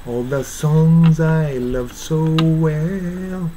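very slow, "so well" linked with the w shaped; slow demo of "love so well". Shown as a demonstration. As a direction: sing out "All the songs I love so well" slowly. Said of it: "言おうかなという口をしながら発音する". (ゆっくり「so well」の口の形で)

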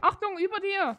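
warning shout. Real, not acted. Warning a teammate of a mob.

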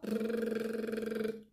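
long loose lip trill; lip roll. Shown as a demonstration. Teacher runs the lip roll in sequence. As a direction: flutter the lips. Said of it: "lip roll".

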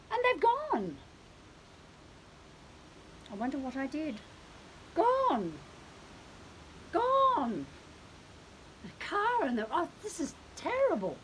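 high cat voice, crestfallen; a cat realizing it's been left alone; dismayed cat. Acted. Cat finds family gone.

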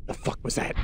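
hushed, breathy whisper of alarm; scared whisper. Real, not acted. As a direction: whisper it in fright. Spooked by a noise.

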